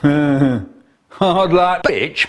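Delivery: mocking line after a chuckle; chuckle, then gloat at the victim; laughing taunt. Gloating at the neighbour who ate it, laughing taunt. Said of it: "I'm standing there laughing".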